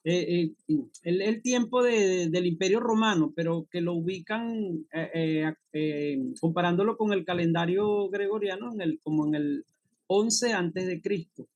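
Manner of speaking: ordinary delivery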